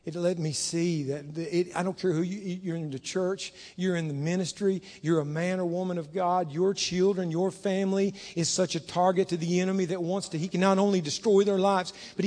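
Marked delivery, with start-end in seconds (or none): none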